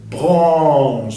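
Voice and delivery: "Branches" with nasal AN after French R; loud "Branches", nasal vowel, no N. Shown as a demonstration. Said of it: "sonido nasal".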